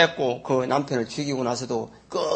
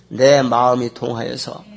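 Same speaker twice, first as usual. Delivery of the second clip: slow, louder leading read; reading the verse aloud; 6 dB louder, slower than usual. Shown as a demonstration. Pastor leading the congregation's read-along, slow and emphatic. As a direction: lead the congregation in the line slowly. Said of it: "따라 읽습니다".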